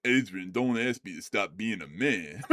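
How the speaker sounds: low, slow, mumbled Rocky imitation. Friend imitates how Matt would sound after surgery, low and slow. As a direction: do a slurred Rocky Balboa. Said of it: "talking like that".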